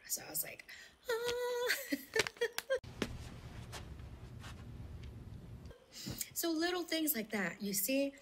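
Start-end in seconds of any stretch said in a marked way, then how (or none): 0.1-0.7 breathy hushed telling, almost secret; hushed whisper. Real, not acted. Whispering to her friend about the test score. 1.1-5.7 squeaky high "Ah" melting into a laugh; a delighted high yelp then giggle; high gasp then laugh. Retold or imitated. Recalling her surprise at the changed test score, high yelp and laugh.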